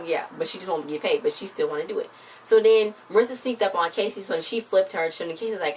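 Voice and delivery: ordinary talk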